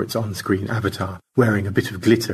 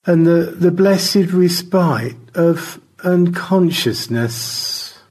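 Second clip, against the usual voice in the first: higher, stretched-out dizzy declamation; George, woozily formal; 8 semitones higher, 5 dB louder, slower than usual. Acted. Spinning astronaut excusing himself to his crewmate before fainting, grandly woozy. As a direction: draw it out grandly as consciousness slips.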